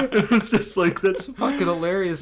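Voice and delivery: high, loud talk through laughter; laughing. Real, not acted. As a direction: crack up while trying to speak. Cracking up after his own impression.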